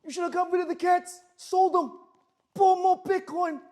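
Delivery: very high, loud mock New Jersey voice; a loud high New Jersey nagging bit; New Jersey accent. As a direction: nag loudly in a cartoonish New Jersey accent. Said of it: "I don't know why I have a New Jersey accent".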